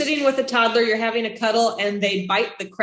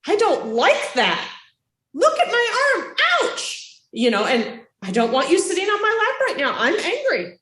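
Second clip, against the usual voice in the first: honest hurt reaction; 8 semitones higher than usual. Shown as a demonstration. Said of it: "you can have an honest reaction".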